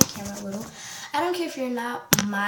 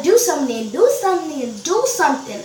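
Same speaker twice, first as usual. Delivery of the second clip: louder, raised, insistent taunt; acted daring; 3 semitones higher, 6 dB louder, faster than usual. She dares the boy to act, acted taunting. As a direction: goad him with each repeat.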